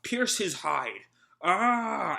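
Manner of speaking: slow charge then a high loud war cry; rally the charge with a yell; samurai battle yell. Acted. Samurai yelling as they charge Kaido, battle cry. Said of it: "the samurai yell out".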